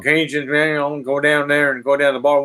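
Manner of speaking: mock Southern drawl at a quick pace. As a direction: rattle it off like a Cajun heading to the bar. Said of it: "They talk like that".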